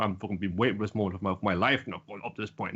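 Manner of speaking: low, understated secretive aside; mock secretive. Retold character waiting years for this moment, low secretive voice. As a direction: drop the pitch as if hiding a plan. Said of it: "I'm super fucking secretive".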